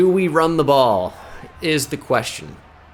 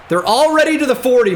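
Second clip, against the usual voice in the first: higher, louder disbelief at the gain; exasperated repeat, pitched up; 9 semitones higher, 4 dB louder than usual. Real, not acted. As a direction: say it again with rising alarm. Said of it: "repeated louder and higher".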